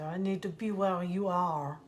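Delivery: French accent impression. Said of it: "he'd say".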